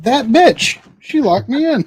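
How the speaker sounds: high, loud indignant dog voice; voicing the dog. About the wife who locked him in, indignant high dog voice. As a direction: put words in the dog's mouth, outraged.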